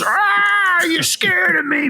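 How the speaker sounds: high, loud creature cry; high shouted monster voice. Retold or imitated. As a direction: shriek it like a creature. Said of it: "he goes".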